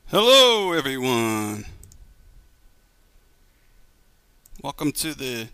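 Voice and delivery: drawn-out, swooping host greeting; stretched-out podcast intro; show-opening announcer. Real, not acted. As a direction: open the show with a long, rising-then-falling hello.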